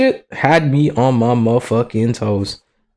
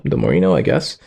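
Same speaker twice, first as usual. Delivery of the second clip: much lower pitch; low underwhelmed repeat; 9 semitones lower than usual. Pretend. Just learned how to open a tab, low underwhelmed. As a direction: drop it right down into the chest.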